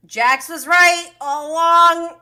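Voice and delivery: loud, vindicated cry; excited exclamation. Real, not acted. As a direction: announce it like a big reveal. Vindicated exclamation while watching.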